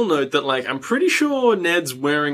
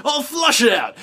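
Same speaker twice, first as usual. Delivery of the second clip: cocky hero; 11 semitones higher than usual. Retold or imitated. Jack boasting, jumps on bed.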